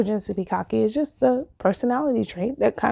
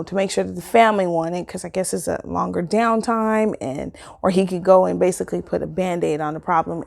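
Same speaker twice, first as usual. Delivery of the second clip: ordinary talk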